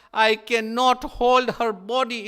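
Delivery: loud, high, slowed; anguished cry. Acted. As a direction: break down over her body.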